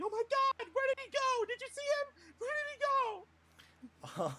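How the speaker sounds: high-pitched frantic mock yell; high, play-acted panic; panicked yelling. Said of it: "just start yelling".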